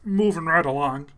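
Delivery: trying out a puppet frog voice; lightly voiced frog catchphrase; Kermit. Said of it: "I'm trying to do Kermit".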